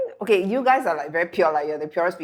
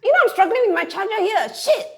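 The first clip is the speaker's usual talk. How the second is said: loud, much higher exasperated shouting; retold angry yell; 7 dB louder than usual. Husband yelling at his wife while fighting the charger, loud. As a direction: shout it like you're fed up with the gadget. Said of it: "he yelled at me and said".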